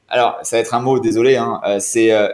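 normal talking voice

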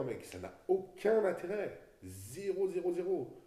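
ZÉRO punched high and loud, slowly; emphatic. Real, not acted. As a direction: hammer each "zéro" hard and high. Emphatic rant against copying pros.

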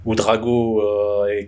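ordinary talk, nothing marked

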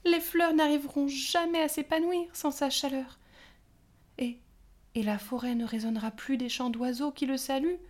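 high fairy voice building from worried to loud lament; rising despair. A narrator's reading. Pâquerette pleads to her sisters, rising to a loud despairing cry. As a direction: panic growing line by line.

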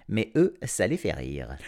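usual speaking voice

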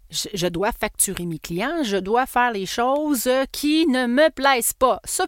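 usual speaking voice

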